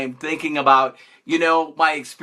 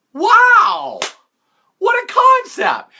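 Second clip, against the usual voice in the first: mock-amazed shout, much higher and louder; sarcastic amazement; 6 dB louder, much slower than usual. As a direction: gasp at the obvious like it's genius. Mocking getting a job as news.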